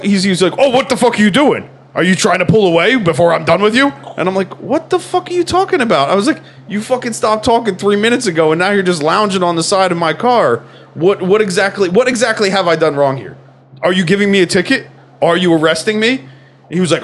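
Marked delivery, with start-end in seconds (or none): 0.6-3.9 retold loud, higher-pitched cop challenge; mock-outraged authority, pushing the pitch up; indignant cop. 4.6-6.1 retold incredulous, high-pitched protest; exasperated retort. Retold exasperated reply to cop. 6.7-16.3 retold arguing back, higher-pitched; indignant protest. Retold argument with the cop.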